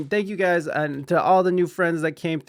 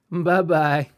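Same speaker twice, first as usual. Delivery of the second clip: ordinary talk, nothing marked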